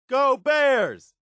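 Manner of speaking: high, excited sports cheer. Acted. Companion cheering the old team at the stadium, excited. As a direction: shout it like a fan in the stands.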